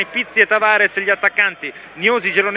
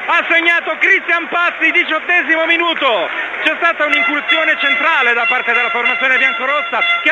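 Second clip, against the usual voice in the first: breathless italian goal commentary; 8 semitones higher than usual. Acted. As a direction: shout the goal to the listeners. Goal call from the stadium.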